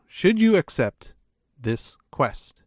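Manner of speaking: normal talking voice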